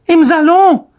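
very high, loud caricature of an aunt; instant eager reply. A narrator's reading. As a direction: fire it straight back in a piercingly high voice. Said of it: "wie aus der Pistole geschossen".

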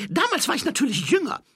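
high, loud, hurried character voice; eager boastful thief. A narrator's reading. Thief claiming a robbery to the watchman, high eager shout. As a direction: brag loudly in a high-pitched put-on voice.

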